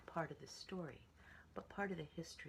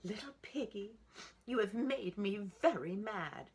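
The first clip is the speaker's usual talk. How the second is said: higher and louder, scolding; mad mother; 7 semitones higher, 9 dB louder than usual. A narrator's reading. Mother pig scolding Little Piggy, loud and mad. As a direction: let her anger rise. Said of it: "Said the mother".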